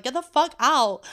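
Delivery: sudden loud yell at the cat; freaked-out yell. Retold or imitated. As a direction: shout him off the sink in a panic. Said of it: "I freaked the fuck out".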